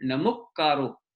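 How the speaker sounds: नमुक्कारो slowly with a doubled क्क; slow careful pronunciation. Shown as a demonstration. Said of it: "उस तरह से ही बोलना है". (धीरे से नमुक्कारो, दोहरा क्क साफ)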